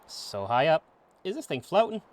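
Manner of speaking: lightly hammed-up Australian accent; bad Australian accent. Streamer voicing Kid out exploring, in a terrible Australian accent. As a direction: put on a rough-and-ready, amateur Aussie accent. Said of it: "How do you like my terrible, uh, Australian accent".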